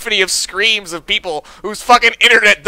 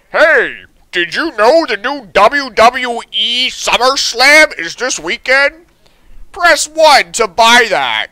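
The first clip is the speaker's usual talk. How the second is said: mock hold ad; slower than usual. Hold message ad imitation.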